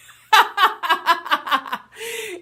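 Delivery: high, loud burst of laughter. Real, not acted. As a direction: crack up at your own joke. Laughing at own joke.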